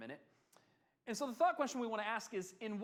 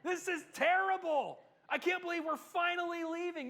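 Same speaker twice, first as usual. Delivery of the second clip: high, louder ironic whine, plainly thrilled underneath; sarcastic mock complaint; 10 semitones higher, 4 dB louder than usual. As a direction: pretend to grumble while clearly delighted to leave. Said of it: "was leaving Egypt going".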